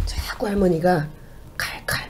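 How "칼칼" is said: cryptic whisper. Acted. Spirit's cryptic word relayed.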